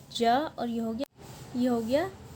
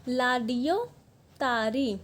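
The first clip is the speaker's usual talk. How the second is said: ordinary talk, nothing marked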